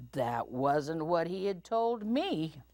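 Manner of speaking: ordinary delivery, nothing marked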